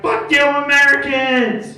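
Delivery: high, loud mocking shout; hammed-up yell from a passing car. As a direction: hurl the insult across the street. Car guy yelling insult.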